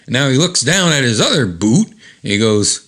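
ordinary delivery, nothing marked

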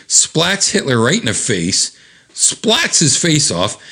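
usual speaking voice

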